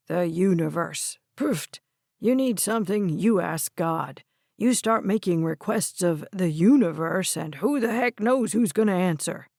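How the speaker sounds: gramps scoffing at newfangled ideas; dismissive old grandfather. Acted.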